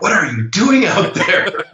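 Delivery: high-pitched alarmed questioning; mock a worried mother demanding answers; concerned mom. Mom calls her son so concerned, high and alarmed. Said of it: "she called me so concerned".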